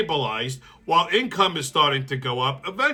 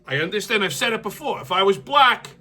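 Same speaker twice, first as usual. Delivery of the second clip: ordinary talk, nothing marked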